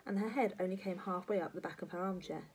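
ordinary delivery, nothing marked

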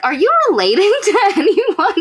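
high, laughing mock question; laughing high quote. Harlow asks the caveman, laughing and high. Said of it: "she's like".

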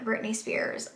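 breathless reverent whisper of the name; awed whisper. Real, not acted. Gushing over [name]'s book.

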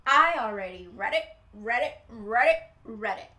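loud croaking read it; bullfrog croak. Acted. Bullfrog brags to the animals in croaks. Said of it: "what he said".

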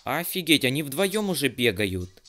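excited. Real, not acted. Reacting to two monsters.

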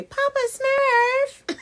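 high, drawn-out squeal; mock Smurfette call. Pretend Smurfette calling to Papa Smurf, squealing. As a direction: call out in a playful cartoon pitch.

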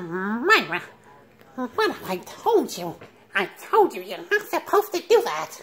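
nerdy high Urkel whine, fussing at Myra; a light take on the squeaky sitcom nerd; Steve Urkel. Retold or imitated. Vlogger imitating Steve Urkel scolding Myra, nasal nerd whine. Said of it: "I'm finna do a voice impression of Steve Urkel".

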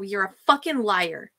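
ordinary talk, nothing marked

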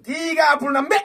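high, loud declaration; rallying shout. Real, not acted. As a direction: proclaim the goal. Announcing target. (teriak target)

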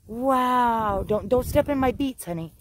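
ordinary talk

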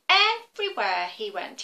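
ordinary delivery

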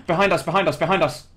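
loud, high alarm call; real startled callout; urgent warning. Real, not acted. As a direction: gasp and shout the warning to teammates. Urgent warning of enemies behind.

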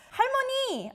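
high excited call of grandma; 할머니를 부르는 들뜬 외침. Retold or imitated.

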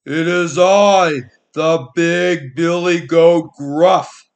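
loud, slow, booming big goat; big goat answering the troll just as loudly. Acted. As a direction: boom it out as the biggest goat. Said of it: "his voice was as loud as the troll's".

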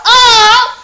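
high, loud squeal into laughter. Real, not acted. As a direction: squeal with glee then laugh.

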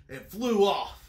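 ordinary delivery, nothing marked

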